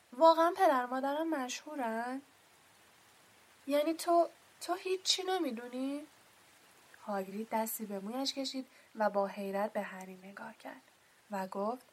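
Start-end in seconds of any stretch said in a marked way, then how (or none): 0.1-6.1 astonished. Acted. Harry stunned by the news.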